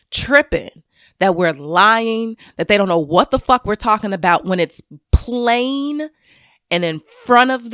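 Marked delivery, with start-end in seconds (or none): none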